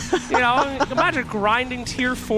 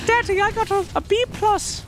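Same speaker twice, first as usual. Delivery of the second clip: higher, a mock little kid; child voice; 9 semitones higher than usual. Caster voicing co-caster's future child, mock kid. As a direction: pipe it up like a proud kid. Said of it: "you're gonna be a great father".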